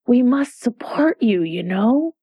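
ordinary delivery

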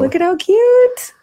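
squeaky high, slow gush over something cute; showing the teapot to the audience, high cutesy gush. Real, not acted. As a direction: coo at the teapot like it's a puppy.

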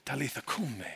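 put-on soft, tender hush to a sleeping girl; sweet whisper. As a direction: say it gently like waking a child. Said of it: "he sweetly whispers to her".

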